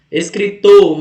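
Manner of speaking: "Escritor" punched loud on the final TOR; teacher demonstrating TOR as the strong end of "Escritor"; stress on last syllable. Shown as a demonstration. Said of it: "mais forte é o to".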